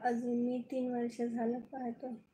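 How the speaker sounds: ordinary talk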